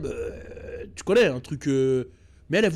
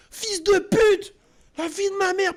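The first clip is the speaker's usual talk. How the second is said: mock screamed insult, much higher and slow; hammed-up enraged girlfriend yelling; furious yelling girlfriend; 13 semitones higher, 3 dB louder, slower than usual. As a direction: shriek it at him like a jilted girlfriend. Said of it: "Elle criait".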